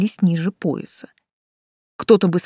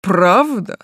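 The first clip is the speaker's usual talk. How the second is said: louder, drawn surprised question; taken aback; 4 dB louder than usual. A narrator's reading. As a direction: query it as if caught off guard. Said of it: "Он явно не ожидал такого ответа". (удивлённо, растерянный граф)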